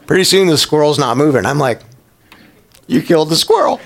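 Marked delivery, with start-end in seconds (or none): 2.9-3.8 disbelieving line through laughter; laughing. Real, not acted. Pastor laughing at his dog over the dead squirrel.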